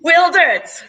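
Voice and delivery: loud, excited promise; excited shout. Real, not acted. Promises a showstopper finale.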